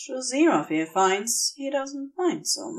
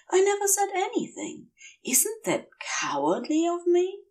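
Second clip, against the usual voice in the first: higher, hesitant and earnest; tender angel confession; 7 semitones higher than usual. A narrator's reading. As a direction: offer yourself shyly, voice pitched up. Aziraphale's confession.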